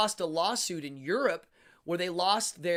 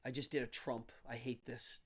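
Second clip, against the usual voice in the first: usual speaking voice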